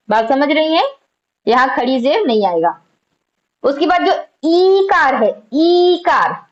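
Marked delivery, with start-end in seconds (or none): 4.5-5.1 ईकार with its ee held long; stretched long vowel. Shown as a demonstration. Teacher showing that it is pulled, ईकार drawn out. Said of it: "इसको खींचा जाता है ना". 5.5-6.4 ईकार with a long, held first vowel; stretched long vowel. Shown as a demonstration. Teacher repeating it for students, ईकार drawn out. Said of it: "इसको खींचा जाता है ना".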